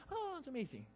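high, dreamy sigh of infatuation; mock swooning admirer. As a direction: gush in a high, mock lovestruck voice.

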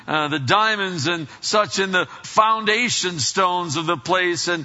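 loud, high, emphatic sermon delivery; full-force preaching. Real, not acted.

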